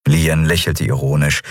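ordinary delivery, nothing marked

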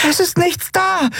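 very high, breathless cry; high-pitched, winded alarm; out of breath. A narrator's reading. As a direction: report back in a panicked rush. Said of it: "ganz außer Atem".

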